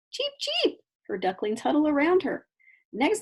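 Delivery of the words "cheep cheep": very high chick peeps; duckling cheeps. A narrator's reading. Ducklings answering their mother, high peeps. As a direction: peep back like happy ducklings.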